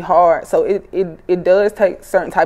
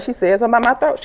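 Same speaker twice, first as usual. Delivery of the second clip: ordinary talk, nothing marked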